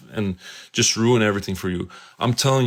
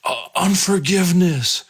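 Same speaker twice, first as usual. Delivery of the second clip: higher demon voice giving its answer; 8 semitones higher than usual. Retold or imitated. Demon answering how it entered the man, higher voice. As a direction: let the demon answer pitched up. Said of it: "this demon, he speaks".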